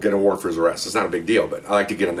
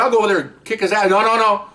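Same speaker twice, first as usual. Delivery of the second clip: angry threat; 11 semitones higher than usual. Retold or imitated. Angry cop threatening to go beat the officer, heated. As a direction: threaten it like you mean it. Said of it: "I'm mad now".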